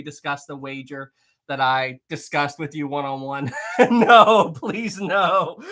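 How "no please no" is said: higher, louder words broken by laughter; laughing. Real, not acted. Streamer reading chat's replies on the wager, laughing. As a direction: laugh through the plea.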